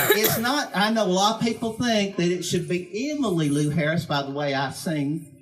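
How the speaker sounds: ordinary delivery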